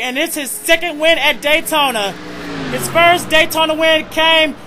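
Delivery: ordinary delivery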